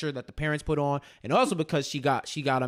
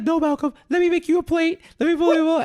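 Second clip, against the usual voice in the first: higher, louder mock eager dad; pitched-up, louder mock dad hospitality; 11 semitones higher, 5 dB louder than usual. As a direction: ham up the overeager host father. Voicing dad welcoming Malcolm.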